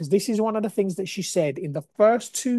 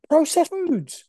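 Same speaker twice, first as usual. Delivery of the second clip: high-pitched incredulous repeat; appalled disbelief; 10 semitones higher, slower than usual. Real, not acted. Telling viewers about modern diets, appalled. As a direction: hit "Processed foods" high, in disbelief. Repeats it, then says it's insane.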